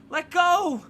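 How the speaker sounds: high, loud yell to be freed. Acted. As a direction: yell at the captor to let go. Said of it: "you yell".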